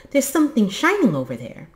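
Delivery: high, loud and fast; excited, hurried shout; excited cry. A narrator's reading. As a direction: call out eagerly in a rush. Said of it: "he cried".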